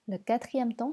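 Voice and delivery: usual speaking voice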